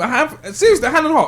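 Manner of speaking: high excited push. Real, not acted. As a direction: raise it up, keen and eager. Excited pick of a song.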